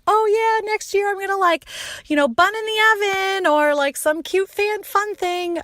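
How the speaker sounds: gushing over a cute idea in her head; imagined cute plan. Retold or imitated. Picturing a cute pregnancy reveal for her husband, high and giddy.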